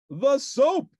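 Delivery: grand, self-important sleuth; Sherlock Hemlock voice. A narrator's reading. Detective answering Ernie's call, pompous and grand.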